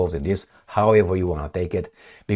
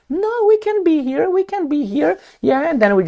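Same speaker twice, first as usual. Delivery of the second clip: much higher, sing-song mockery of NATO; mocking high voice. Commentator mocking NATO's reply to Russia, squeaky high sing-song. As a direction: squeak it out like a cheeky intruder. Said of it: "the Russians are like".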